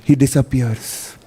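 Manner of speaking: ordinary delivery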